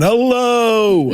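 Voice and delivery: long, high-pitched call into empty space; mock holler across a huge canyon, drawn out; high hello sent out into the void. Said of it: "it's like yelling into the Grand Canyon going".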